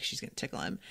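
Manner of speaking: whispered knowing aside; whispering a gleeful prediction. Retold or imitated. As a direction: hush it like a secret guess. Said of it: "I'm like".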